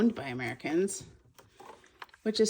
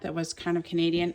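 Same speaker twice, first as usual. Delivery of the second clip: ordinary talk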